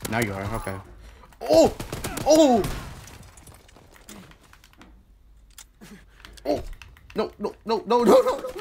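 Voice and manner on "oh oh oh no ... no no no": loud high frantic cries; panicked yelling. Real, not acted. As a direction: yell in panic as you lose the fight. Panicked game moment.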